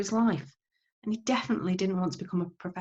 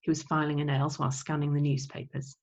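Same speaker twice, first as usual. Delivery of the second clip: ordinary delivery, nothing marked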